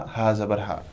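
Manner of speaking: normal talking voice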